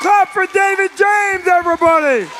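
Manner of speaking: very high, loud announcer shout; hype shout. Real, not acted. Host to the audience, loud hype shout. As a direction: whip the crowd into applause.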